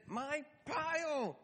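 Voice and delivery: put-on high, slow stubborn child's insistence; be a small boy claiming what's his; indignant toddler. Eli insisting to his dad, high and indignant. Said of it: "And he said".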